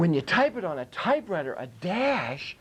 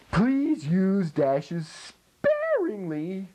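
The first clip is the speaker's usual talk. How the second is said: slow advice; much slower than usual. Acted. Teacher advising slowly.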